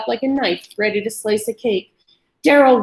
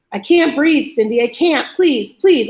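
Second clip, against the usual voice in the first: higher, louder, sobbing plea; 5 semitones higher, 4 dB louder than usual. A narrator's reading. Collapsed captive begging Cindy, sobbing. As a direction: beg through tears.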